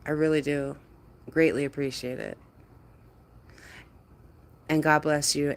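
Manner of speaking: ordinary talk, nothing marked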